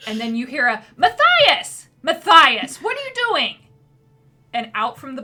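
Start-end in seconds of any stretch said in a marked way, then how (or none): 1.0-3.6 loud, high call across the store; mother calling. Acted. Mother calls her son from the back room. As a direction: yell for her child from the back.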